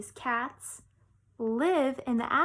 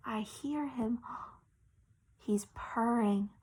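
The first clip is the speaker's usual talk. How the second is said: a soft amazed whisper at the purring; hushed whisper; 6 dB quieter than usual. Retold or imitated. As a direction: whisper it, breathless with wonder. Child hearing kitten purr.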